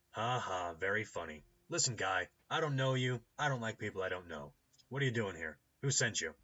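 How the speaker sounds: loud, unimpressed gatekeeper voice; brusque doorkeeper. Acted. Doorkeeper questioning a stranger over the intercom, brusque and loud. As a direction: challenge the stranger loudly and curtly.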